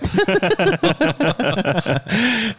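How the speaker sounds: big high laugh. Real, not acted. Friends joking, big laugh.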